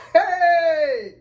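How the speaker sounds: loud, high, drawn-out mock wail; anguished cry out. Junior torn between senior and rescuer, loud despairing wail. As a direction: howl it in comic despair.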